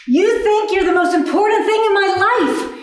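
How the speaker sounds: mock tirade at the cable company; angry rant. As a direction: let it all out at the company. Ranting at the phone company.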